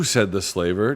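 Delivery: plain reading voice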